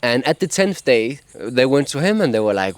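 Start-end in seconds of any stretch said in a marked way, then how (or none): none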